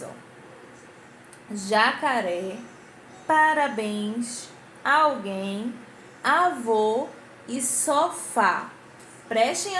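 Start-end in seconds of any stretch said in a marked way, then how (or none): 1.5-8.7 slow word list, stretching the end of "Jacaré, parabéns, alguém, avô, sofá"; stressed final syllables. Shown as a demonstration. Teacher showing pupils oxytones, last syllable of "Jacaré, parabéns, alguém, avô, sofá" drawn out. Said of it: "ela demora mais a sair da minha boquinha".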